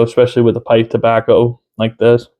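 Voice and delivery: ordinary talk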